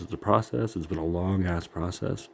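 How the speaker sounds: ordinary delivery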